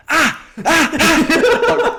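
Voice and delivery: high, loud yells breaking into laughter; retold yell. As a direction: roar yourself on for the final sprint. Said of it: "out loud, like really loud".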